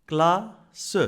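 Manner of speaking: "Classe" as cla, pause, seu; teacher's syllable split, cla... se in "Classe". Shown as a demonstration. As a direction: break "Classe" into two beats, sounding the final e. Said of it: "Deux syllabes".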